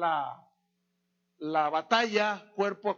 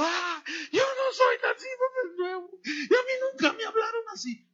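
high sobbing cry, louder; 13 semitones higher, 3 dB louder than usual. Retold or imitated. Pastor at the altar call confessing, sobbing high cry. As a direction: wail it out like a broken pastor. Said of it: "Y pasó llorando".

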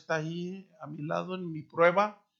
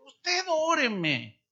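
put-on insistent old woman; 8 semitones higher, much slower than usual.

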